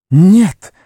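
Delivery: high, loud shout; Gollum crying out. A narrator's reading. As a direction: cry it out sharply. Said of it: "вскричал Горлум".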